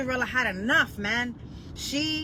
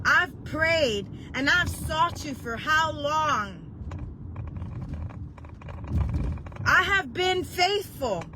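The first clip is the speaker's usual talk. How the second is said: higher, slow pleading to God; retold crying prayer; 5 semitones higher, slower than usual. Preacher reliving her prayer to God, slow pleading. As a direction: plead slowly, as if near tears. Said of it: "I remember crying to God and telling him".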